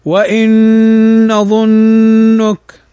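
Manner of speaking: slow drawn-out recitation with ghunna; slow Quranic recitation; tajweed recitation. Shown as a demonstration. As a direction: chant it slowly with nasal merging. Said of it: "تقرأ هكذا".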